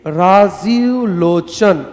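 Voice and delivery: Rajiv Lochan very slowly, each syllable stretched. Shown as a demonstration. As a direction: drag out Rajiv Lochan as if dictating. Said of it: "देखिए". (राजीव लोचन बहुत धीरे, खींचकर बोलें)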